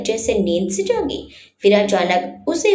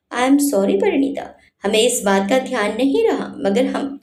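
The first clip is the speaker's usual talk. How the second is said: usual speaking voice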